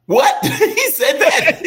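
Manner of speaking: high, loud laughing disbelief; stunned disbelief. Real, not acted. Hearing the senator's Guam fear, laughing disbelief. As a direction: gasp it out, can't believe it.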